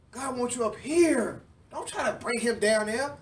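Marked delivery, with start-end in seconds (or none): none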